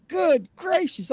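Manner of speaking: high, drawn-out astonishment; retold flipping out. Storyteller relives the shock at the cove, high astonishment. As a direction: stretch it out slow, pitch way up. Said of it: "I was flipping out".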